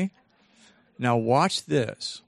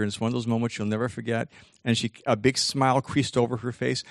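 ordinary delivery

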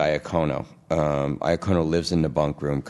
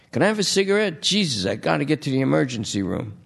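high, fast, defensive whining junkie; pleading junkie; 8 semitones higher, faster than usual. Acted. Junkie Lester pleads with detectives, high and fast. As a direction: rattle off excuses like a nervous addict.

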